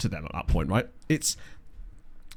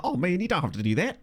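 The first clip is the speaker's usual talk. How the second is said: higher-pitched, hammed-up New Zealand accent; mock Kiwi accent, pitched up; bad New Zealand accent; 5 semitones higher than usual. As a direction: badly imitate a New Zealander. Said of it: "That was the worst New Zealand accent of all time".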